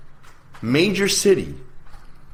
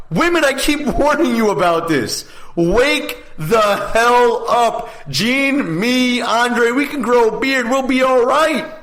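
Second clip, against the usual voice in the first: high-pitched, impassioned raised voice; heated warning; 8 semitones higher than usual. Real, not acted. Radio host urging women to take notice, heated high-pitched rant.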